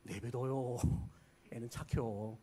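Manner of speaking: hushed Chungcheong dialect. Retold or imitated. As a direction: mutter it again like a sly elder. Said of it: "충청도 어른들의 최고 욕".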